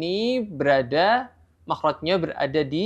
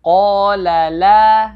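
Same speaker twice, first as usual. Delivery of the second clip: slow chanted recitation, louder; Quranic recitation; 3 semitones higher, 9 dB louder, much slower than usual. Shown as a demonstration. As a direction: recite slowly with held vowels. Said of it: "Jadi bacanya harus mat tabi'i dua harakat".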